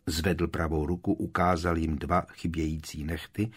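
ordinary delivery

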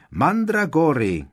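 high, drawn-out call over the shoulder; křik za běhu. A narrator's reading. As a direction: call it out loudly to someone behind you. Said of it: "křikl Neville v běhu přes rameno". (zvolání přes rameno)

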